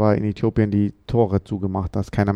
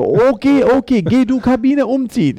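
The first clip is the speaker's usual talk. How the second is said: much higher, louder broken foreigner-talk German; mocking the club boss in exaggerated simple German; condescending boss; 14 semitones higher, 6 dB louder than usual. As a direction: talk down to him in pidgin German, pitched way up. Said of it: "Und dann er so".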